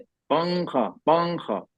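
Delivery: पंखा with the n hidden in the nose; nasal ikhfa. Shown as a demonstration. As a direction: say पंखा through the nose, no tongue touch. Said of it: "हमने छुपा दिया नून को नाक में".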